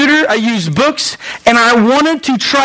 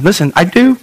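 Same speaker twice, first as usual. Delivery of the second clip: ordinary talk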